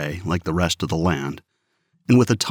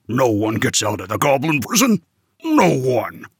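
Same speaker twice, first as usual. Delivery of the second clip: bellowing; 4 semitones higher than usual. Acted. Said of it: "bellowed the guard".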